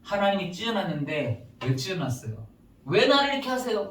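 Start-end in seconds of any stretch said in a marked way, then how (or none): 2.8-3.9 high-pitched mock complaint; protesting to God. Believers question God about being torn, high protest. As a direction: throw it up as an aggrieved question. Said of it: "이렇게 하더라고요".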